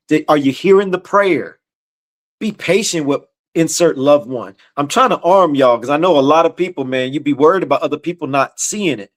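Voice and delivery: normal talking voice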